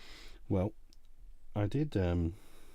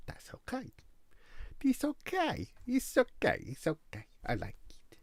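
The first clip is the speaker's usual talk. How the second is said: high, exaggerated Italian accent; hammed-up comic Italian accent. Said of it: "Italian accent".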